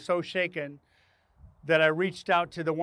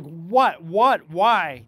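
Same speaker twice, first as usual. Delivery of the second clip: confused alarm; 2 semitones higher, 8 dB louder, much slower than usual. Retold or imitated. Coworkers' confused reaction.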